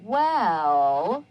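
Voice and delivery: "Well" drawn out with a lifted ending; lingering, hesitant "Well"; pause word. Shown as a demonstration. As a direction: stretch "Well" and hang before the comma. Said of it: "The person is kind of saying".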